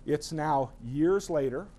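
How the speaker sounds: ordinary delivery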